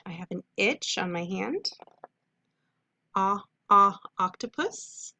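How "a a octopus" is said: teacher modeling short o; short o sound. Shown as a demonstration. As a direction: isolate the short o, A, a, then say octopus.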